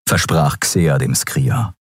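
plain reading voice

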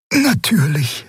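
high, whispered character voice; whispery agreement. Acted. Voices Xea agreeing, airy whisper. (hoch gehauchte Zustimmung)

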